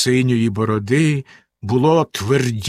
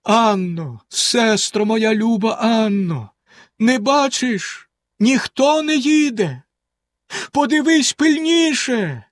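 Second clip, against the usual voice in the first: slow, high desperate call; calling in despair; 9 semitones higher, slower than usual. A narrator's reading. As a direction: cry out to the tower. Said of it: "гукнула з розпукою". (з розпукою)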